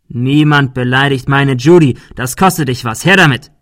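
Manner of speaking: loud, rising threat ending in a bark; bully shouts at the girl to hand over her money; furious demand. Acted.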